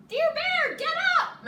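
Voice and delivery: very high loud shout; squeaky mouse shout. Acted. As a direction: yell right in his ear. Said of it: "Mouse shouts in his ear".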